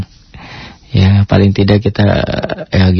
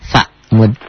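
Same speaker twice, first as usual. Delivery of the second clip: normal talking voice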